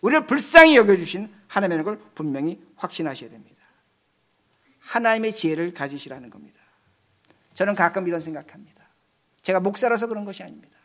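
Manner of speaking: ordinary talk, nothing marked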